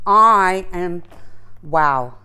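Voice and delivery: ordinary delivery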